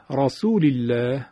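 رسول الله as 'rasuli-lleh', with a light L; thin lam. Shown as a demonstration. Teacher giving a last kasra example, thin lam. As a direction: keep الله thin and fronted after the 'i' of رسول.

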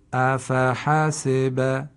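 slow, drawn-out blended word; slow blended reading. Shown as a demonstration. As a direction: read the whole word slowly, sounds joined. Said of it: "به صورت کامل و به صورت ترکیب".

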